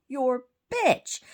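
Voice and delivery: punchy re-enacted retort; retold defiant shout. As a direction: throw it back at him loudly. Repeats own insult to the husband.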